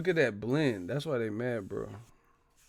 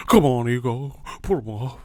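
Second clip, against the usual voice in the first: mocking fan goading a fighter; man fans impression; 2 semitones higher, 3 dB louder than usual. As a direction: huff it out like a heckling fan. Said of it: "that's how these man fans be sounding".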